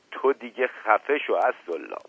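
usual speaking voice

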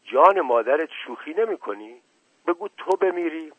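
anxious, disbelieving question, pitch rising; worried astonishment. A narrator's reading. Mashqasem presses his townsman to swear it's true, worried and astonished. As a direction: ask as if you can hardly believe it, worried. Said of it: "با نگرانی و تعجب پرسید".

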